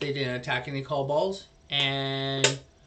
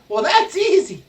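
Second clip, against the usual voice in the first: high, loud squeaky little creature; kobold voice; 13 semitones higher, 10 dB louder than usual. Acted. Meepo answering the player, high and eager. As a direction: chirp it out cheerfully. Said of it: "He's like".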